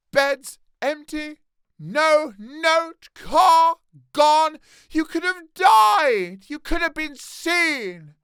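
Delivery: furious mother scolding her son; Molly Weasley scolding. Acted. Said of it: "Molly Weasley".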